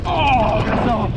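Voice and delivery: sudden high startled gasp. Real, not acted. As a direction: react to a near miss. Startled on the descent.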